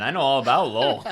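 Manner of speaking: laughing. Real, not acted. Laughing about hometown rival city.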